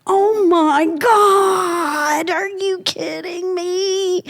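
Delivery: high, slow, drawn-out disbelief; high stretched-out oh my God; astonished. Retold or imitated. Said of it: "he goes".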